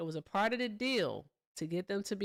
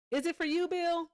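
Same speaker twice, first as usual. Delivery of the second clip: high, sing-song tease; teasing a chat viewer, high and sing-song; teasing question; 10 semitones higher, 3 dB louder than usual. Real, not acted. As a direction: coax Bill with a high lilt.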